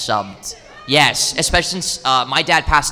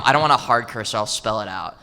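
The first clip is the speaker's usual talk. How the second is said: ordinary talk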